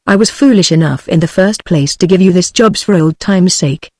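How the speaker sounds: ordinary delivery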